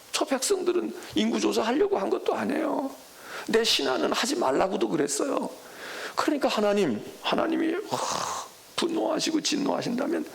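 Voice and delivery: high, straining plea to God; David's desperate plea. Acted. David pleading with God for his people, voice high and straining. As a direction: beg God to spare the people.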